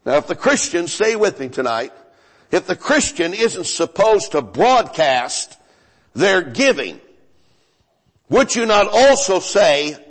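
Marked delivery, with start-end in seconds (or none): none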